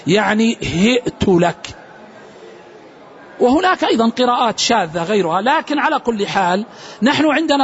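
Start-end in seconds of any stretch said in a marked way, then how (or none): 0.6-1.7 هئت said as hi'ta, a glottal stop after the h; هئت as hi-'ta laka, with kasra and hamza; Quranic reading hi'ta. Shown as a demonstration. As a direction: read هئت لك slowly, with the hamza clearly stopped. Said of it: "بكسر الهاء وبالهمزة وفتح التاء".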